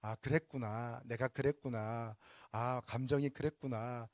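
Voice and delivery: slow reassuring demo voice; calm understanding. Shown as a demonstration. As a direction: let each word settle gently. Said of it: "이렇게 말씀하시면 좋겠습니다".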